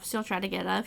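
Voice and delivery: usual speaking voice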